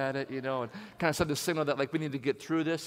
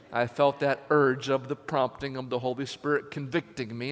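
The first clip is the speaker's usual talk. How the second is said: ordinary talk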